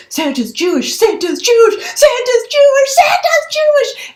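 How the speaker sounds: building from normal to high, loud chant. Retold or imitated. Little girl announcing the news to everyone. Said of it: "saying louder and louder and louder".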